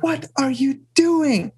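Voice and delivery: high, slow, alarmed cry; frightened outburst. Retold or imitated. Rumi, whose book was thrown down the well, cries out in high alarm. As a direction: shriek it as if your treasure were just destroyed. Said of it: "frightened, angry, upset".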